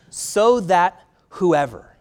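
whoever drawn out slowly; slow stress on whoever. Acted. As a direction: linger on the word whoever. Stresses the key word.

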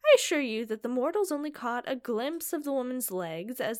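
loud, insistent vampire protest; Phineas pleading. Acted. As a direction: demand they hear him out.

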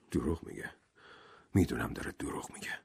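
soft unvoiced whisper; to himself. Doctor Armstrong whispering to himself about the judge's lie. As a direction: whisper it under your breath. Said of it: "به خودش گفت".

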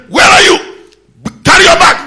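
high, loud urgent call; Mordecai shouting. Acted. Mordecai summons Esther to the palace, loud urgent shout. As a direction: shout it so she runs. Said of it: "Mordecai came and said".

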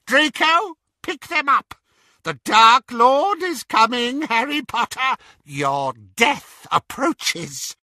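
high, loud, gloating witch voice; taunting witch. A narrator's reading. Bellatrix taunting Harry as the Dark Lord nears, high and gloating. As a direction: crow it at the prisoners, triumphant.

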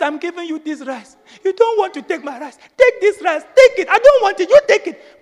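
put-on old woman crying on stage, pressing her rice on the pastor; insisting old woman. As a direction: beg him to take it, rising to a cry.

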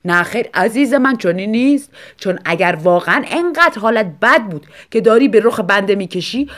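husband grumbling in self-defence. A narrator's reading. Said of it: "آقای آنسلمو قرولند کنان گفت".

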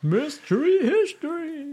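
high, slow and theatrical; dramatic sing-song. Pretend. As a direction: stretch it out like a movie trailer. Echoing a phrase for dramatic effect.

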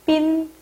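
빈 held level on a high tone; model pronunciation. Shown as a demonstration. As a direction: read out 빈 high and steady as a loanword. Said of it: "읽어볼게요".

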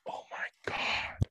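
slow hushed whisper; shocked whisper. Retold or imitated. Distant shoppers reacting, slow whisper. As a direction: breathe it in disbelief. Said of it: "people four check stands away".

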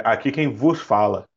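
low, formal captain announcement; pilot over the radio. Retold or imitated. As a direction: speak like a captain on the intercom. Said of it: "comandante de avião".

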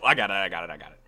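normal talking voice

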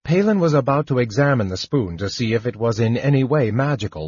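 plain reading voice

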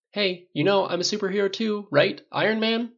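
high, playful fishing for recognition; joking. A narrator's reading. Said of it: "snorted and joked".

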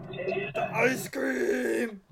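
slow, stretched, worried exclamation; drawn-out alarmed cry. Real, not acted. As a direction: stretch it out in dismay. Ice cream about to drop.

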